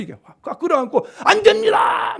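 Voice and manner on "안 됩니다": high, loud cry of refusal; impassioned protest. Acted. Peter grabs the Lord to stop him, shouting in protest. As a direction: shout it as you cling to someone. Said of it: "앞에서 그냥 붙잡고 확 끌어안은 거예요".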